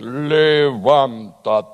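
slow, deep, drawn-out command; deep voice of God. Retold or imitated. Studio singer voicing God for the song, slow deep command. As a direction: call Lazarus from the tomb, low and slow. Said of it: "busqué yo el tono más grave".

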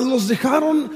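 ordinary talk

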